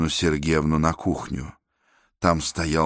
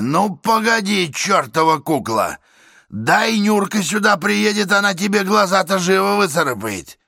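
louder, higher angry threats; threatening rant; 12 semitones higher, 3 dB louder than usual. Acted. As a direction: berate the bourgeois neighbours. Man in cap threatens neighbours.

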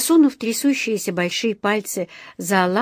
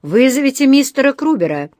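high, loud courtroom summons; raised, carrying call; 6 semitones higher, 5 dB louder than usual. A narrator's reading. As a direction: shout it from the back of the hall. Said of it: "крикнул кто-то в дальнем конце зала".

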